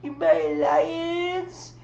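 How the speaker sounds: high, slow, whiny pout about not getting a hug; whiny Vanessa. Retold or imitated. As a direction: drag out the complaint like a hurt child. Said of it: "And I was like".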